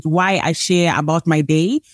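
ordinary talk

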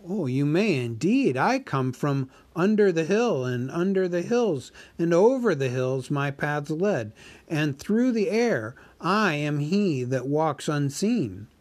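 a small hobbit flattering the dragon; Bilbo the hobbit. Acted. Narrator voices Bilbo.

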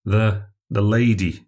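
usual speaking voice

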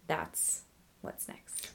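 slow, soft teasing whisper; dramatic whisper. Pretend. Cohost teases the next topic to his husband, slow whisper. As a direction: hush it like a cliffhanger tease. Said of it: "Thanks for that transition there".

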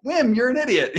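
high, loud retort, half-laughing in the retelling; yelling back. Retold or imitated. [name] shouting back at Wim during their fight, mock angry yell. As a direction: fire the insult straight back. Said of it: "we have this fight".

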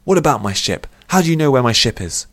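agitated outburst; alarmed exclamation. A narrator's reading. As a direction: demand answers, building in pitch. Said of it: "exclaimed Sagar".

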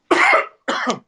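sudden choking cough on water; sputtering cough, water down the wrong pipe. Real, not acted. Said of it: "my water went down the wrong pipe".

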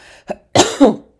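loud, rasping sick cough; a chesty cough from a virus; harsh cough. Real, not acted.

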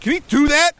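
put-on high, loud startled kid voice; kid asking coach. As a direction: squeak it out like a confused kid. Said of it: "looks over at his coach, and he's like".

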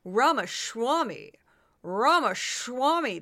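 Ramaswamy said slowly, rah-ma-SHWAH-mee; Ramaswamy with an sh sound. Shown as a demonstration. Podcaster demonstrating the hidden sh in Ramaswamy, slow. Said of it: "There's an H sound in there".

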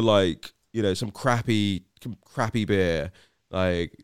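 usual speaking voice

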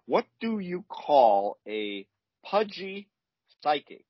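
slow, drawn-out joke setup; milk the riddle slowly for groans; telling a dad joke. Shown as a demonstration. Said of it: "delivery is gonna be important here".

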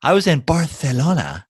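put-on Spanish accent lisping Barcelona as Barthelona; mock Castilian accent, lisping Barcelona. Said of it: "that's a good accent".

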